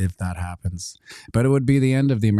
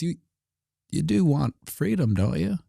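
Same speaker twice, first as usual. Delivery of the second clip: coaxing official; 8 semitones higher than usual. Retold or imitated.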